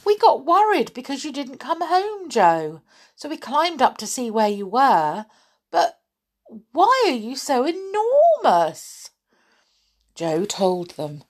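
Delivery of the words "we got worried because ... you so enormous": high, excited storybook voice; wide-eyed, high-pitched amazement; amazed child character. A narrator's reading. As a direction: blurt it out high, ending in astonishment. Small boy astonished at giants.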